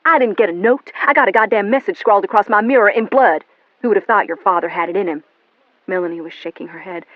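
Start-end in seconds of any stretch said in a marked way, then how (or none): 0.0-5.2 loud, bitter outburst; angry. A narrator's reading. William raging at Melanie about the message. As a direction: throw the words at her. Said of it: "He frowned at her angrily".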